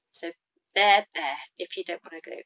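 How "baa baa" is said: loud bleating baa; sheep bleat. Shown as a demonstration. Teacher bleating again for the class.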